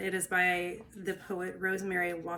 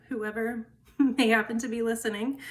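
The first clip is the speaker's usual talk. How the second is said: ordinary talk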